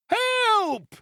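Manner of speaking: very high, drawn-out cry for help; piercing shriek. Acted. Boy shrieks for help to the crowd outside. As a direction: tear the air with one word. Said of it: "shrieked the boy in a voice that rent the air".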